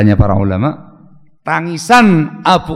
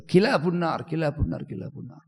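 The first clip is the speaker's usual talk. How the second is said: softer each time, ending in a whisper; fading repetition; 11 dB quieter than usual. Retold or imitated. Abu Umamah repeating dogs of hell, fading into a whisper. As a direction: let the words die away to a breath.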